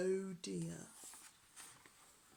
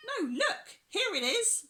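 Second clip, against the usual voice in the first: high, loud pirate voice; Peg Polkadot; 13 semitones higher, 7 dB louder than usual. A narrator's reading. Peg points out the treasure, high-pitched and loud. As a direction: pipe up high and excited. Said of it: "said Peg Polkadot".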